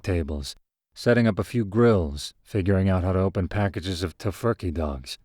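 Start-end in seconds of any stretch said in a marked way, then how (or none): none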